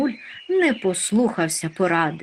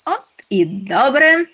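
high, loud, drawn-out delight; cheered up; 8 semitones higher, 8 dB louder than usual. A narrator's reading. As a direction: brighten up at the news, pitched high. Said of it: "повеселів Чмих". (радісно, високо й протяжно)

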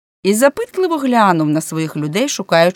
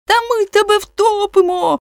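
very high, angry shout; thundered threat; 4 dB louder than usual. A narrator's reading. Policeman threatening the man below, high angry shout. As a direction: roar the threat down the well. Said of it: "гримнув Рафаель".

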